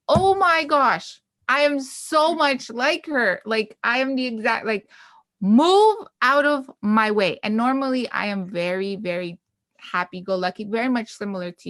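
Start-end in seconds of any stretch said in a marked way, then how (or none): 0.1-4.9 excited. Retold or imitated. Excited recognition, mock anger. 5.4-7.4 mock angry. Quoting her angry self.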